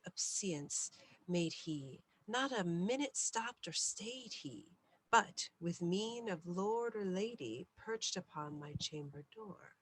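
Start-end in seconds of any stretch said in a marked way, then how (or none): none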